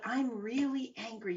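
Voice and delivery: notice it with interest, not heat; softly curious realisation; curious self-observation. Shown as a demonstration. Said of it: "you've got that curious hat on".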